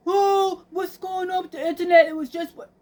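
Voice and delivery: high, loud mock panic; imitating a pestering son; agitated son. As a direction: whine the questions up high like a worked-up son. Acts out son fretting about internet.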